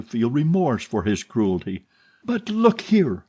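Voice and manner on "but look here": much higher, slow urgent cry; cried out. A narrator's reading. Young man calling after the heroine, urgent cry. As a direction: call her back in alarm. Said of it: "he cried".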